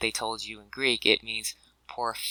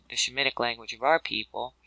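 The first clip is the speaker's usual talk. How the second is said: ordinary talk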